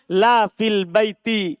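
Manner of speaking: slow spaced reading; deliberate teacher demo; slow example. Shown as a demonstration. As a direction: lay out la fil baiti for students. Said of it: "Contoh tidak terpenuhi syarat".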